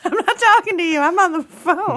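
high, loud, laughing gangster's moll voice; sassy, brushing him off with a laugh; flapper voice. Retold or imitated. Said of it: "why does Zini sound like Flapper".

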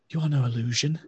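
awed elf. Acted. Old elf to the party, a touch of awe.

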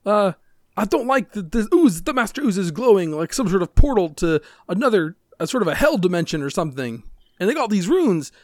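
high, loud fretful character voice; alarmed game character. Acted. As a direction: panic out loud in a high pitched voice.

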